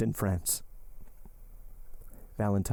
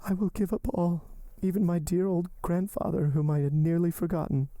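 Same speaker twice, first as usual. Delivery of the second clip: much higher, pleading heroine voice; desperate young woman; 8 semitones higher than usual. Acted. Heroine entreating her lover to live, high and pleading. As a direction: lift the voice and entreat him.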